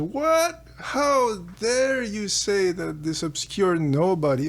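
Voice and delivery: a sharp, high "What?" then mock outrage; the offended king snaps at Solon, hammed-up; indignant king. Croesus hears Solon name a nobody, mock indignant. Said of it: "And Croesus says".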